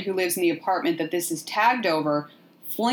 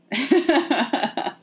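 high burst of laughter; 7 semitones higher than usual. Real, not acted. Podcaster amused by the pet getting all the attention, laughing.

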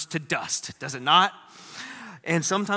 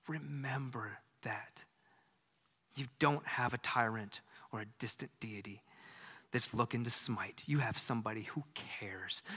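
whisper; 6 semitones lower, 11 dB quieter than usual. Real, not acted. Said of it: "intimate appeal to listeners".

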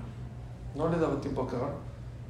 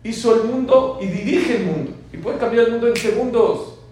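higher, louder proclaiming; full-force preaching; 7 semitones higher, 10 dB louder than usual. Acted. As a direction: declare God's might to the crowd. Preaching God's power at full force.

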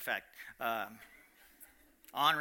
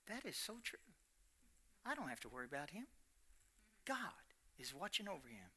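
hushed peace; 15 dB quieter than usual. Retold or imitated. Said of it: "And he said".

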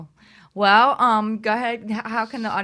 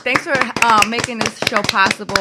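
much higher, loud cheering thanks; excited thanks; 6 semitones higher, 12 dB louder than usual. Real, not acted. Host cheering thanks to the radio team.

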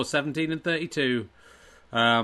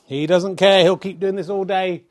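mock commentator shout; 3 semitones higher, 8 dB louder than usual. Snooker commentary on a comedy shot.

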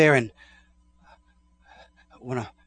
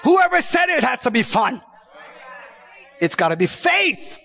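preaching at full force; 12 semitones higher than usual. Acted. Preacher shouting faith to the congregation, full force.

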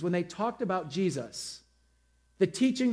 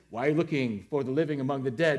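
angels quoted; 5 semitones lower, faster than usual. Retold or imitated. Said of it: "This is where dead".

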